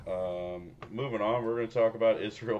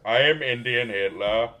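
loud hammed-up Indian accent dictator speech; Indian accent Hitler; 3 semitones higher, 9 dB louder than usual. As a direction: bellow it like a ranting leader in a thick Indian accent. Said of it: "I leaned so hard into the Indian voice".

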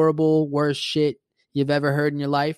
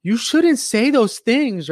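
high, slow, loud motherly reproach; imitated scolding mom; 9 semitones higher, 5 dB louder, slower than usual. Mom scolding her teenage son, imitated high and alarmed. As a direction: draw it out as an appalled mother. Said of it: "She's like".

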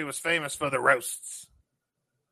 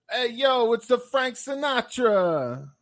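higher, louder mock crooner swagger; 9 semitones higher, 5 dB louder, slower than usual. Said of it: "I'm gonna try to do a, uh, impersonation".

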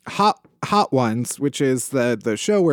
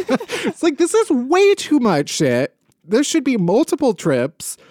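mock outrage; 9 semitones higher than usual. Imitating shocked shoppers.

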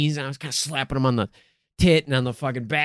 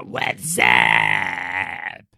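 prank caller voice; 8 semitones lower than usual. Retold or imitated. Prank calling the business.

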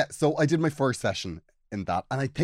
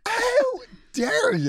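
mock outrage; 3 dB louder, much slower than usual.

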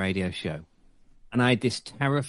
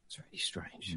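hushed, scared whisper; whispered thought; 6 semitones lower, 17 dB quieter than usual. Retold or imitated. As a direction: breathe it out as if afraid to be heard. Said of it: "and I'm thinking".

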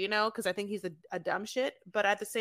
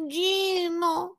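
high, whiny pleading voice, much higher; wife begging husband to let her stay; mimicking Jasmine; 6 semitones higher than usual. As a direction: plead with Gino in a put-on higher voice. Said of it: "she's like".

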